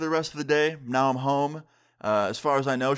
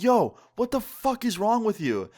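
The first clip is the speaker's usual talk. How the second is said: high, drawn-out retold outrage; mock-confront someone, pitch jumping up; exasperated, higher-pitched challenge; indignant outburst; 9 semitones higher, slower than usual. Confronts her after hot chocolate throw.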